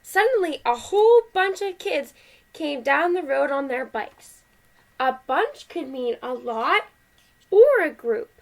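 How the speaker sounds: lively, measured picture-book reading; animated read-aloud with well-marked phrases; story read to children. A narrator's reading. Parent reading Duck on a Bike to kids, animated story voice.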